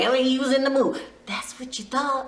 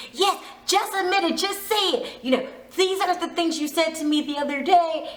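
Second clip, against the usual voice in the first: higher, pushy mock accusation; imitating a jealous girlfriend; mock accusing insistence; 7 semitones higher than usual. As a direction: press him to confess. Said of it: "he was like".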